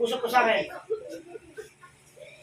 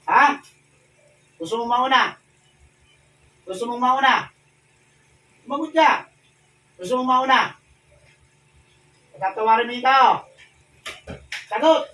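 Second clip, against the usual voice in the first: loud, higher, forceful demands; shouted commands; 4 semitones higher, 8 dB louder than usual. Real, not acted. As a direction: shout down the spirit. Exorcist interrogating a spirit.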